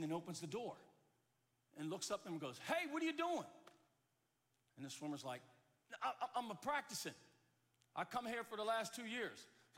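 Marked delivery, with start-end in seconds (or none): 2.6-3.5 high, sharp challenge from below; calling out. Acted. Custodian calls up to diver. 6.0-9.5 lightly acted flustered stammer, higher; caught-out swimmer. Startled swimmer explains himself.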